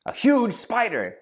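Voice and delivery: high, drawn-out alarm on huge; wife pointing out a huge spider in alarm. Retold or imitated. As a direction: stretch out huge in fright. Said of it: "It's like".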